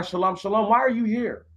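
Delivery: usual speaking voice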